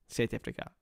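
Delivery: low, very soft South African accent; understated, hushed South African accent. Shown as a demonstration. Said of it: "Do a South African accent".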